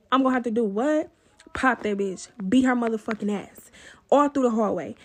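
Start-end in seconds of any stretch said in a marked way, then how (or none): none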